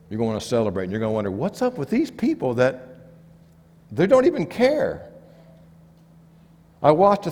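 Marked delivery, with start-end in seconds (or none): 1.3-5.1 higher-pitched, incredulous questioning; baffled disbelief. Acted. Freed vet wondering at people who don't care, baffled. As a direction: climb sharply in pitch into mock disbelief.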